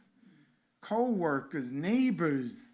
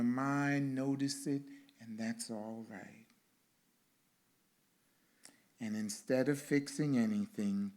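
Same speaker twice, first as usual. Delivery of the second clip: guided meditation. Acted. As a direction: lead the congregation into stillness gently.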